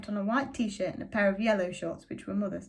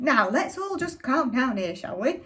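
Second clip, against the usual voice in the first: higher, louder, slowed call to calm down; shouted plea for calm; 4 semitones higher, 5 dB louder, slower than usual. A narrator's reading. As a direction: try to shout them both down, slowly. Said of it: "And Mister Shears shouted".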